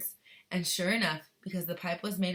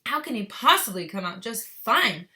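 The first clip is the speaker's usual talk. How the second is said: louder, sharp retort; snap; 3 semitones higher, 10 dB louder than usual. Acted. Mother snapping at Mister Wonka. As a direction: snap back at him. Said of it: "snapped Missus Gloop".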